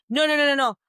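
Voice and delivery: retold children's protest. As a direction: cut in like kids objecting. Children interrupt her practice speech.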